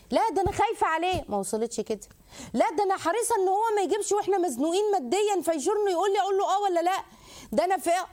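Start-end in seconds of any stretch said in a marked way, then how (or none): 0.1-1.2 caring worried wife. Shown as a demonstration. Wife shows concern to husband, caring. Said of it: "توصل للي قدامك كده". 2.5-8.1 high, earnest caring plea; worried caring wife. Shown as a demonstration. Wife voices worry over money to husband, caring. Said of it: "توصل للي قدامك كده".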